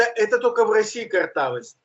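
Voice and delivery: usual speaking voice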